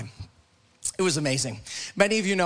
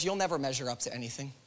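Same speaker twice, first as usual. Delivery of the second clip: accusing inner voice; 5 semitones lower, 8 dB quieter, faster than usual. Acted. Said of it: "It's that voice that says".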